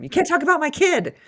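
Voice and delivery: high, loud playful yell; mock yell. Mother yelling at her self-critical son, high playful yell. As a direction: yell it up high, half joking.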